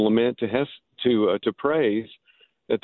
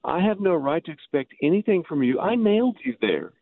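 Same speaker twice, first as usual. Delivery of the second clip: higher-pitched plea to Jesus; incredulous confession; 7 semitones higher than usual. Acted. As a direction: confess it to him in disbelief. Acted address to Jesus at the cross.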